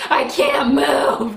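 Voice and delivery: slow, high, loud protest; retold helpless yell. Labouring woman answers nurses in tub, slow loud yell. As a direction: re-enact a drawn-out wail of helplessness.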